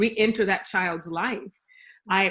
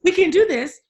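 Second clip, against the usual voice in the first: mock eager; 8 semitones higher, 8 dB louder than usual. Mocking naive new foster parent.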